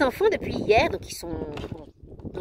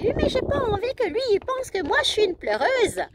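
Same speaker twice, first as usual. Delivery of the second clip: put-on little girl voice; 11 semitones higher than usual. Daughter voiced in retold chat.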